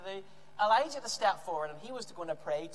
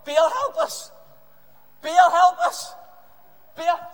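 loud, high chant-like plea; acted repeated cries for help; shouting out; 10 semitones higher, 15 dB louder, much slower than usual. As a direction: shout it to a distant god. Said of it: "they shouted out to Baal".

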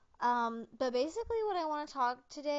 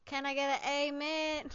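mock preacher call; 5 semitones higher, 7 dB louder than usual. Calls for an amen.

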